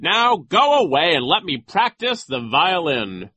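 high, loud, drawn-out dismissal; imperious, high-pitched brush-off; Nero. A narrator's reading. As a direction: shoo them away like a pompous official. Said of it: "Nero said".

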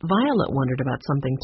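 plain reading voice

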